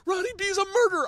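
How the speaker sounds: very high, loud fan squeal; thrilled raving. Retold or imitated. Fan raving about the perfect interview, shrill-pitched excitement. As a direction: gush at the top of your range.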